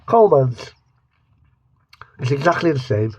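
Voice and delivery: usual speaking voice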